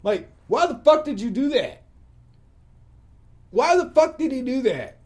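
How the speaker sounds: loud, high-pitched disbelief; baffled outburst. Retold or imitated. Venting about the guy who spoiled the fight, loud and baffled. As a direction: demand an answer, loudly baffled. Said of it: "I'm kinda baffled still".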